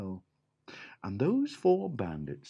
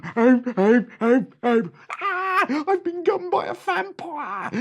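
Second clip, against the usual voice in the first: very high, loud cries for help; terrified bandit; 9 dB louder than usual. Acted. Bitten bandit screams about a vampire.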